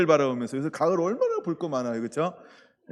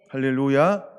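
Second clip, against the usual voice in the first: 할렐루야 called out louder, rising at the end; 6 dB louder than usual. Real, not acted. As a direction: lift 할렐루야 up to rouse the room. Preacher calls out again, louder.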